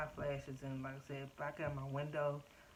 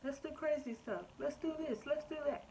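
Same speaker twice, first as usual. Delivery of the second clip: high, giddy voice for her emotions; voicing wild emotions; 11 semitones higher than usual. Mother voicing her runaway emotions, high and giddy. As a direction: let the emotions squeal their plans. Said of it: "my emotions are just like".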